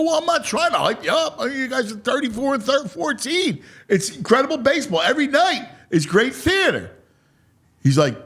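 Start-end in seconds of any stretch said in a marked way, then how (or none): 0.0-6.9 high, rapid gushing; excited defence. Retold or imitated. Fan telling the player it's great theater, excited defence. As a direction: rush it out high, hyping while denying it.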